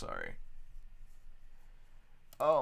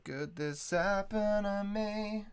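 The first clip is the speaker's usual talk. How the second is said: slow, high dramatic woe; hammed-up tragic sigh; mock lament; 7 semitones higher, 4 dB quieter, much slower than usual. Mock lament over audio woes.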